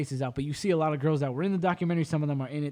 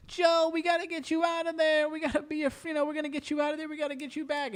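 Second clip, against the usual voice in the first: a hint of a fawning celebrity; mock celebrity pleading to free Joe; 11 semitones higher than usual. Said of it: "being like".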